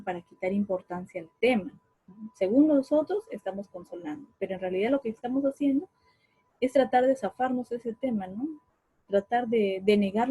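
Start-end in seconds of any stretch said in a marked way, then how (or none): none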